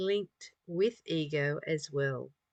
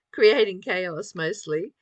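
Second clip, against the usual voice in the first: usual speaking voice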